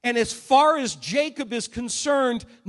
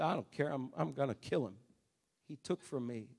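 very low, hushed vengeful resolve; cold anger; 8 semitones lower, 11 dB quieter, faster than usual. Retold or imitated. Preacher as Esau refusing to let it go, cold anger. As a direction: say it softly, dropped right down. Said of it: "Esau's so angry".

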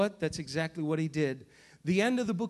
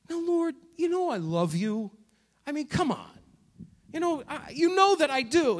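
annoyed Peter; 7 semitones higher than usual. Retold or imitated. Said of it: "Peter starts to get a little bit annoyed".

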